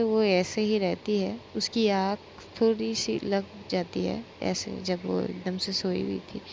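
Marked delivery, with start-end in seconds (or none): none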